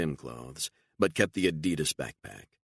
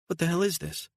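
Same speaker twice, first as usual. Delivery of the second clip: much higher, stunned disbelief; shocked; 3 dB louder than usual. Acted. Beatrice seeing the cash, shocked and high. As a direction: gasp it out in shock.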